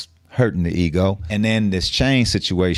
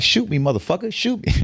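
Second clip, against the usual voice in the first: defiant dare; 11 semitones higher than usual. Retold or imitated. Daring the gunman to shoot.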